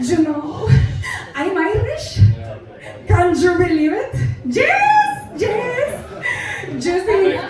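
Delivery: put-on chatty, boastful mom with a Mexican accent; Mexican-accented English, announcing it to strangers; Mexican mother, accented. Mother tells everybody in Dublin Airport, proud Mexican accent. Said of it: "But my mother was as bad".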